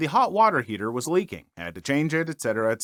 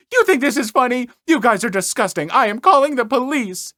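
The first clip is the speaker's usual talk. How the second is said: much higher, loud shrieking threat; furious Karen; 9 semitones higher, 6 dB louder than usual. Retold or imitated. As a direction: storm off threatening the police. Karen's final outburst.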